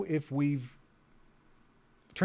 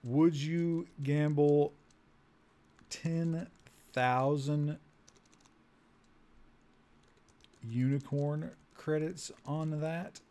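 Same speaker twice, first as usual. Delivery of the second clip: drawn-out words with long pauses; slow dictation; 3 semitones higher, 4 dB quieter, much slower than usual. Real, not acted. Player typing a bet on unicorn credits, slow dictation. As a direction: say it piece by piece as you type. Speaking slowly while typing input.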